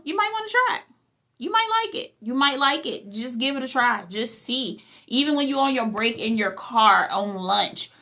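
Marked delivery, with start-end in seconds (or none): 0.0-2.1 high coaxing. Acted. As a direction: jump way up in pitch, coaxing. Coaxing viewers to try the tone.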